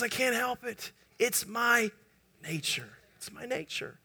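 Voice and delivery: very soft, halting scorpion's last words; acted dying scorpion, barely voiced; hushed confession. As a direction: let it fade to almost nothing, with pauses. Dying scorpion confesses as both sink.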